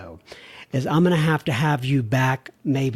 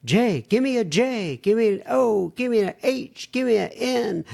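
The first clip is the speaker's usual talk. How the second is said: higher-pitched pep-rally chant; cheerleader; 5 semitones higher than usual. Pretend. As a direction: spell it out like a cheer squad. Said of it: "I sound like a cheerleader there".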